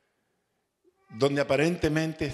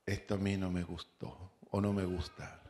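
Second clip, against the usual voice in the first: God's voice; 5 semitones lower, 9 dB quieter than usual. Acted. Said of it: "Dios me va a decir".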